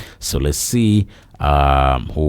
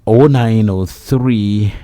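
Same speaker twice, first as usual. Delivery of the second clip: reading out a phone number slowly, digit by digit; 4 semitones higher, slower than usual. Acted. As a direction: spell the line out slowly for listeners. Repeats the station number.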